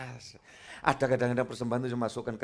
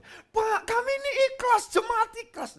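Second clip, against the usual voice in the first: insisting colleague; 3 dB louder than usual. Retold or imitated. Fellow pastor insisting on gift.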